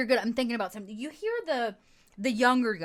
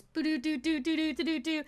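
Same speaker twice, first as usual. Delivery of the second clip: sing-song doo-doo melody, higher voice; imitated electronic jingle; 6 semitones higher than usual. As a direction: mimic a little tune in doo-doos.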